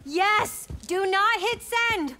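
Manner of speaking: loud, high, slow urgent yell; urgent shout. Real, not acted. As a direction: shout to stop them. Stops post before sending.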